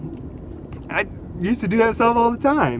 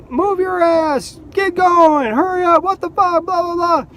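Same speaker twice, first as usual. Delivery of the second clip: much higher, whiny jeering voice; mocking college kids; 11 semitones higher than usual. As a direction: mimic rude kids heckling from their car. College kids yelling at him.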